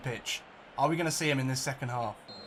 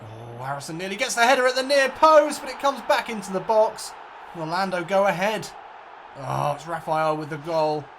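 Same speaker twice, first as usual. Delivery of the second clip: excited play-by-play; 3 semitones higher, 6 dB louder than usual. Acted. Gamer commentating his player's near miss, excited. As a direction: ride the rising tension of the move.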